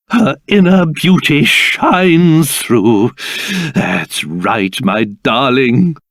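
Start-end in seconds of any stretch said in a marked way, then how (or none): 3.7-6.0 soft, low, slow endearment; tender reassurance. Acted. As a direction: soothe your darling, very gently and low. Doting words to the transformed princess.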